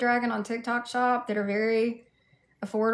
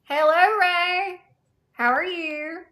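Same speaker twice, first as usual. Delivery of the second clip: normal talking voice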